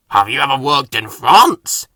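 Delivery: Salty. Acted. Salty chiming in with an eager question. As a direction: chime in keenly. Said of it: "added Salty".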